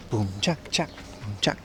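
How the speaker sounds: low, hushed mouth beat; soft beatbox. Pretend. Streamer beatboxing a low, quiet boom chak.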